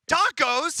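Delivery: high, loud rising question; thrilled guess; excited. Real, not acted. Unwraps the food.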